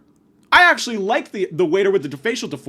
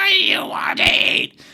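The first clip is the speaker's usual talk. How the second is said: mocking odd voice; 11 semitones higher, 3 dB louder than usual. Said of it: "her voice was, like, insane".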